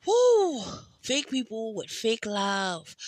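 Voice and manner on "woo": high, loud emotional whoop. Real, not acted. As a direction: shake off the tears with a shout. Releasing emotion, overwhelmed.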